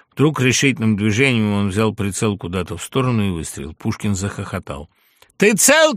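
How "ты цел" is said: high, loud anxious cry; panicked yelled question; alarmed shout. A narrator's reading. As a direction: call out urgently to a friend. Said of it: "крикнул Дельвику".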